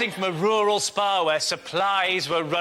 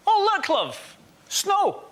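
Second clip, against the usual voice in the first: much higher, breezy Scottish voice; casual Scottish; 10 semitones higher, slower than usual. Retold or imitated. Comedian imitating unbothered Scots in the snow, higher and breezy. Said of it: "Scottish people do this".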